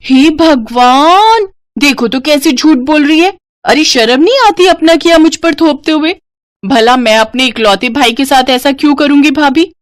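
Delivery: put-on a scheming girl feigning shock; outraged sister-in-law. (ननद का बनावटी गुस्सा)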